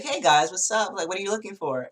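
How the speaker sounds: lightly voiced Irish receptionist; Irish accent. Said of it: "And with her Irish accent".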